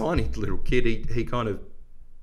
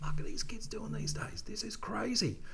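hushed, breathy whisper of disbelief, pitched way up; amazed whisper; 9 dB quieter than usual. Retold or imitated. Watching kids do backflips on his bike, stunned whisper. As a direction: whisper it like you can't believe your eyes. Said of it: "And I'm like".

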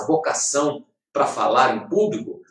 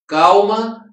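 calma called out higher and louder; emphatic calm; 8 semitones higher, 8 dB louder than usual. Retold or imitated. God reassuring a despairing person, a louder higher calma. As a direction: say calma again, raised and louder. God's answer repeated.

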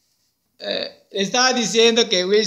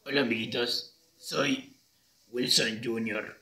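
low, slow, soft character voice; thicker, deeper voice; 8 semitones lower, 7 dB quieter, slower than usual. Shown as a demonstration. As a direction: drop way down and introduce yourself slowly. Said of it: "un poco más gruesa". (voz gruesa, grave y pausada)

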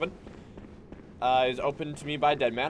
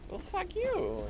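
much higher squeaky comeback; mock retort; slower than usual. Gamer retelling his own retort to the enemy, high. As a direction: snap back in a high voice. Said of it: "I'm like".

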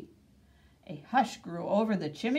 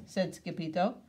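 ordinary delivery

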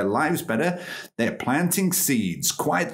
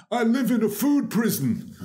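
quoting Ron Finley; 10 semitones higher than usual. Retold or imitated. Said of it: "this is what Ron says".

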